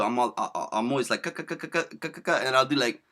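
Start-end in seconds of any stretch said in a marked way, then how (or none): 1.2-2.4 rapid string of "ka" syllables; imitated verbal stutter. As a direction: mimic your own stuck syllables, staccato. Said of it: "I notice I have many syllables too".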